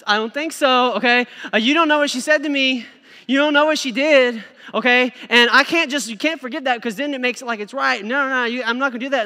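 put-on full sassy teen girl refusing to forgive. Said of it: "The girls do it sometimes".

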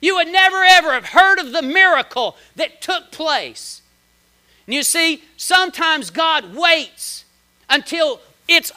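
loud, high, slowing on the key words; full-force preaching. Acted. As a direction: preach it to the back row.

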